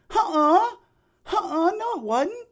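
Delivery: high, loud imitation of her protest; indignant denial. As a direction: squeal a mock objection. Acts out the woman's protest.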